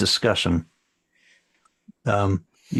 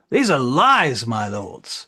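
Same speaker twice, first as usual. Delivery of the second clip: louder, higher councillor's protest; crying out; 4 semitones higher, 4 dB louder, slower than usual. Acted. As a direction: burst out in protest, dropping off at the end. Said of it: "of the council cries out".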